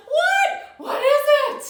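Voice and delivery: high, loud startled grandma; alarmed shout. Acted. Grandma rushing to the screaming girl, alarmed high shout. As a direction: cry out in alarm.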